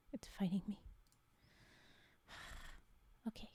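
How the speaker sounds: whispered frustrated aside; whispering. Real, not acted. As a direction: mutter it under your breath. Muttered unvoiced aside.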